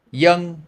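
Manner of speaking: put-on "young" said high and loud with a Y sound for V; Southern Vietnamese name demo. Shown as a demonstration. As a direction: model "young" for students to copy. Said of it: "match my tone".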